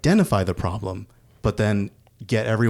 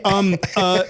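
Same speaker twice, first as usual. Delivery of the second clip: high, hesitant mock stammer; awkward stammering caller; 10 semitones higher, 3 dB louder than usual. Telemarketer interrupting a couple having sex, awkward. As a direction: play a flustered caller, pitched up.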